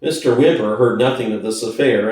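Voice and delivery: ordinary delivery, nothing marked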